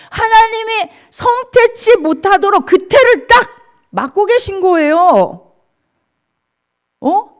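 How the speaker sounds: high, loud, emphatic proclamation; forceful preaching. Real, not acted. Preacher telling listeners who closed the womb, forceful preaching. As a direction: hammer each phrase home to the congregation. Preacher drives the point home.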